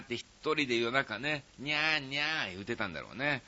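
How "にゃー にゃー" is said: soft imitated meows; cat meow imitation. Host retelling the listener's night-time cat act, soft imitated meows. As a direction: meow softly, half-acting the cat. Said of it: "猫の鳴き真似".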